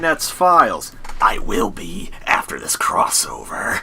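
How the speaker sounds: raspy, breathy horror-movie killer whisper; menacing masked-killer hiss, then whisper; Ghostface impression. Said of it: "can you do that in your Ghostface impression voice".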